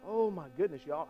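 ordinary talk

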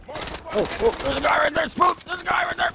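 shrill warning cry, very high; alarmed high shout. Real, not acted. Spotting an enemy.

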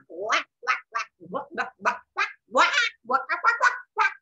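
short duck calls, building to a squawk; duck quacking. Acted. Imitates duck at the pond.

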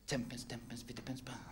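soft whispered step count; hushed dance-step counting; muttered counting. Acted. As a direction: count the steps under your breath. Mate demonstrates counting dance steps.

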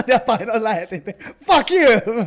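loud shout breaking into laughter; laughing shout. Real, not acted. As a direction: crow it through laughter. Laughing at his own defiance. (nauraa ja huutaa)

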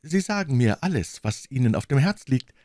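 high, anxious queen's voice; a frightened queen defending herself; a queen begging to know her crime; pleading queen. Acted.

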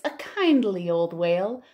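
ordinary delivery

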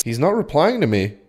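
ordinary talk, nothing marked

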